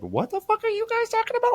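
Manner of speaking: very high, incredulous imitation; mock bafflement. Imitating brother-in-law left out of the talk, high-pitched bafflement. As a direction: squeak it out like a baffled onlooker.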